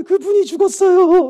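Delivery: high, loud imitated wailing; mock sobbing. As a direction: wail the words through hammed-up sobs. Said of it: "우는 거예요".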